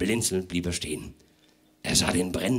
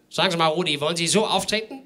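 fussy host voice; 7 semitones higher, faster than usual. Acted. Host voiced in read story.

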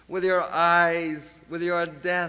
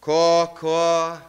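louder crow call, then back to reading; crow caw; 4 dB louder than usual. Acted. Poet cries like a crow.

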